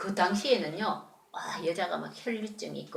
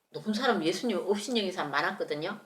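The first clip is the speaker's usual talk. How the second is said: normal talking voice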